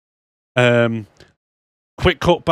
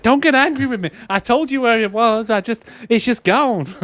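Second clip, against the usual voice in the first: much higher, whining and scared, slowing at the end; panicky pleading; 11 semitones higher than usual. Retold or imitated. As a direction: beg him not to shoot you. Scared lad pleads at gunpoint.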